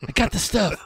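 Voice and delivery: lightly voiced stoner at the door. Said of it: "The stoner voice is an easy one to do".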